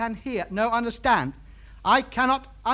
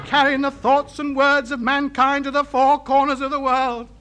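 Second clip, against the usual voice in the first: building into a proud louder hymn to radio; grand gushing rapture; 5 semitones higher, 5 dB louder than usual. Real, not acted. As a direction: croon to the valves like a lover. Rhapsodising to his radio valves.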